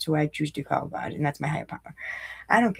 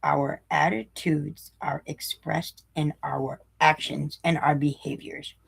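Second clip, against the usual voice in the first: each word spelled out slowly for the group; slow, deliberate reread; 3 dB quieter, slower than usual. Shown as a demonstration. Reading the key line again to the group, slow and deliberate. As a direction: lean in and slow right down. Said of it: "One more time".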